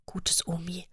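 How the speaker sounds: soft unvoiced child's whisper. A narrator's reading. Little Lea whispering while dabbing grandma's brow. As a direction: breathe it tenderly like a little girl. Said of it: "Dabei flüstert sie".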